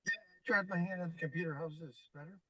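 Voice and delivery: muffled, distant tube sound; hollow, boxed-in audio; through a tube. Real, not acted. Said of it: "it sounds like you're in a tube".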